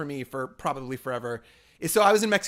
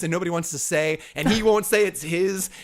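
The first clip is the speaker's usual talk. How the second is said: ordinary talk, nothing marked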